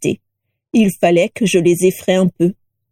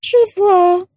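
high, drawn-out sigh of a reply; sighing shrill portrait; 11 semitones higher than usual. A narrator's reading. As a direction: sigh it in a thin, piercing voice. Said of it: "soupira Prisca".

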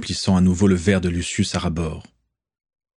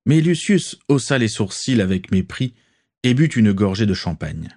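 ordinary talk

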